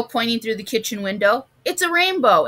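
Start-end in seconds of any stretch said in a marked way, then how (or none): 1.6-2.5 high-pitched excited discovery; exclaiming. A narrator's reading. Amy spots a rainbow through the window, exclaiming. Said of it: "exclaimed Amy".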